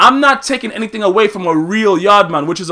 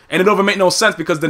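ordinary talk, nothing marked

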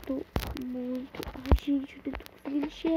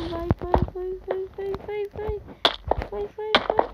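scared horror game streamer; 5 semitones higher, slower than usual. Real, not acted. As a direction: beg it on in fear. Panicked urging.

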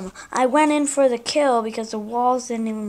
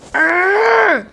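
high, loud groan of frustration; loud exasperated "urgh"; frustrated growl; 8 semitones higher, 6 dB louder than usual. Real, not acted. Player losing the fight, frustrated groan.

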